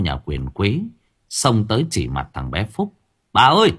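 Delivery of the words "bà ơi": loud, high calling out; excited servant shout. A narrator's reading. Servant calling his mistress that he found the boy, loud and high. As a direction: yell to the mistress that he's found him.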